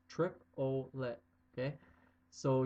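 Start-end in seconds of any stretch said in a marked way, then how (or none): 0.1-1.2 "Trip-o-let" split slowly into trip, o, let; "Trip-o-let" spoken slowly, each syllable apart. Shown as a demonstration. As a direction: break "Trip-o-let" into three slow syllables. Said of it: "you break up the word triplet into three syllables".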